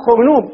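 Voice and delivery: "خرنوب" said xurnub, u in the first syllable; damma on the kha. Shown as a demonstration. Teacher explains the damma form, "خرنوب" said xurnub. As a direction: keep the n and round the first vowel of "خرنوب". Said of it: "يضمونه من دون حذف الباء".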